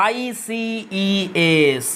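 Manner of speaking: letters of আইসিইএস drawn out one by one; spelled out slowly. Shown as a demonstration. Teacher spelling the ending for students, very slowly. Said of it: "বসাবো কি?".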